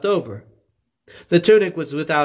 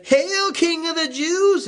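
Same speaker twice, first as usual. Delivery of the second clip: high, slow, sneering mock salute; sarcastic hail dragged out; mocking soldiers; slower than usual. A narrator's reading. Soldiers jeering at Jesus, mocking high and slow.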